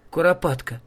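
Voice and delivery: ordinary delivery, nothing marked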